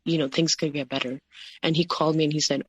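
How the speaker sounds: ordinary talk, nothing marked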